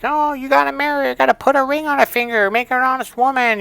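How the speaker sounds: high, loud mocking pastor voice. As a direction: preach it at him in a silly high voice. Mock pastor lecturing him.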